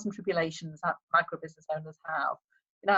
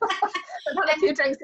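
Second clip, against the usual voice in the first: high, slow words through laughter; laughing; 8 semitones higher, slower than usual. Real, not acted. Guest admits drink brings out her Liverpool twang, laughing.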